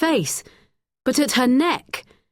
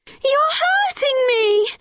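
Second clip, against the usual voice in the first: much higher, slow pained cry; girl crying out in pain; cried out in pain; 3 dB louder, slower than usual. A narrator's reading. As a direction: cry out as his grip hurts. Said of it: "cried Becky".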